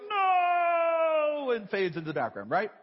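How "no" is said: higher, louder mock villain "No"; cartoon villain. Preacher mocking a cartoon villain fading away, hammed-up wail. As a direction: ham up a defeated cartoon baddie. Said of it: "And the bad guy goes".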